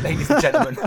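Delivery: high, loud, laughing through the words. Real, not acted. Host cracks up sending off the top tip, laughing loudly. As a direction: burst out laughing as you announce it.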